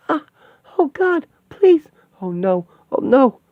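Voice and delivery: high panicked cries; terrified screams. Acted. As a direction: scream in terror. Victims attacked, screaming.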